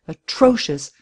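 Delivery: ordinary delivery, nothing marked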